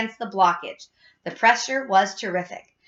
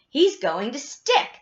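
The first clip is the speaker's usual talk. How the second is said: slow, higher, drawn-out prediction; suspenseful; 4 semitones higher, slower than usual. A narrator's reading. Charlie watches Augustus slow down, suspenseful. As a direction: stretch it out in suspense.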